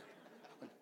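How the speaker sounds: unvoiced whispered urging. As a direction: whisper to them to hold on. Comforting tortured believer.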